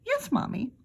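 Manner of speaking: put-on best little boy voice. A narrator's reading. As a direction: sound like a perfect angel. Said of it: "his best little boy in the world voice".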